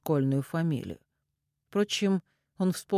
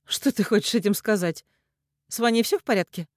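higher, louder worried woman; urgent alarm about her son; anxious questioning; 7 semitones higher, 5 dB louder than usual. A narrator's reading. Mother asks if Ваня is all right, anxious.

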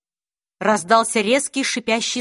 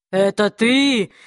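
low demon voice; 3 semitones lower, slower than usual. Acted. Demon questions the man.

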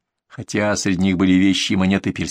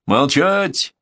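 commanding, raised-pitch bark; barked order; 5 semitones higher than usual. A narrator's reading. Commander silences the bandit with a barked order. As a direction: cut him off with a drawn-out command. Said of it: "рявкнул Григорий Таратович".

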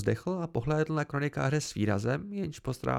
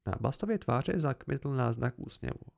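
ordinary delivery

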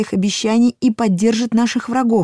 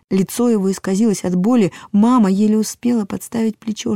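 plain reading voice

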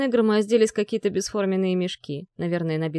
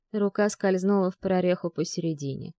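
plain reading voice

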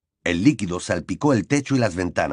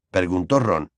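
ordinary delivery

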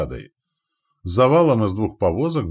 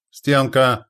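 high, loud one-word bark; roared command; 6 semitones higher, 6 dB louder than usual. A narrator's reading. Андрей orders the spear wall before impact, roaring. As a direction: roar it to the whole rank. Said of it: "рявкнул Андрей".

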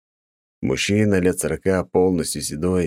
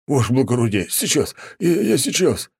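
trembling shout; 8 semitones higher than usual. A narrator's reading. Janitor feigning panic to the wounded viscount, shaky cry. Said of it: "дрожащим голосом выкрикнул дворник".

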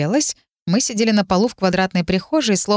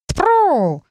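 yelled high call; shouted call; 10 semitones higher than usual. Acted. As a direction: shout the name out sharply. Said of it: "выкрикнул кот". (выкрик)

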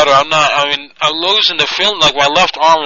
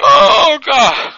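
pained moan; 9 semitones higher, 4 dB louder than usual. Pretend. Caller faking chest pain.

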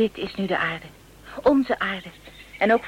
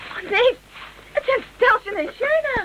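surprised; 11 semitones higher than usual. Acted. Friends suddenly appear.

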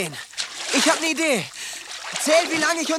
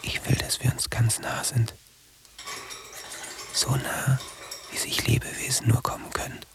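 low, toneless whisper; breathy inner whisper; 12 semitones lower, 7 dB quieter than usual. Acted. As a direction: murmur it as if from inside your head. (leises, tonloses Flüstern)

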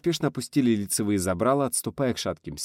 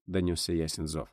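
ordinary delivery

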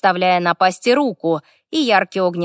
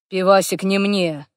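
usual speaking voice

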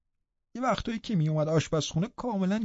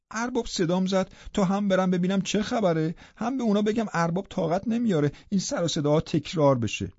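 plain reading voice

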